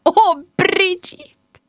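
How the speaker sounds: high, drawn-out gasp of alarm; dismayed mum. Acted. Mum spotting her upset daughter, crying out in dismay.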